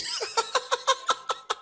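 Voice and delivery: high run of laughter; helpless laughter. Real, not acted. Preacher recalling everything was against him, laughing. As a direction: laugh high at your own bad luck.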